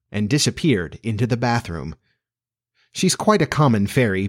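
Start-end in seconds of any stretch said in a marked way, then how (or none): none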